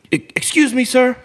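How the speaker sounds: high, exaggerated polite voice; mock polite teen. Teenager mockingly addressing him, high polite voice. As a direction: put on fake politeness. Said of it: "he's like".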